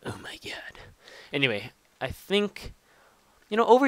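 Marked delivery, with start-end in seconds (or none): 0.1-0.8 breathless whisper of shock; whispered sheep reaction. Pretend. Voicing the strange-looking sheep, hushed whisper. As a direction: whisper it like the weird-faced sheep. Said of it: "They're just like".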